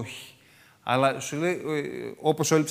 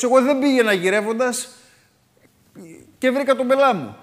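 higher and louder, fed-up protest; exasperated lament; 10 semitones higher, 7 dB louder than usual. Retold or imitated. Someone who never looked for trouble, loud exasperated protest. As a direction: throw up your hands at bad luck. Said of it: "ή θα πει κάποιος".

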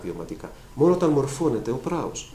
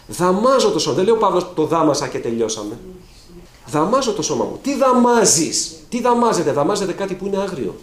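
preaching at full force; 5 semitones higher, 6 dB louder than usual. Acted. Preacher urging the faithful to tame the body, fiery. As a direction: drive each question home from the pulpit.

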